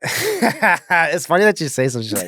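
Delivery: high, loud laugh while talking. Real, not acted. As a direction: laugh as you speak. Laughs at question.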